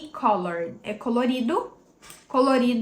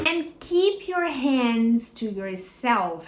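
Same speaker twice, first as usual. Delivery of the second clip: "hands" as the strongest, clearest word, the line slow; slow stressed demo; much slower than usual. Shown as a demonstration. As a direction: make "hands" stand out. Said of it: "hands é a palavra mais bem falada dessa frase". ("hands" como a palavra mais forte, devagar)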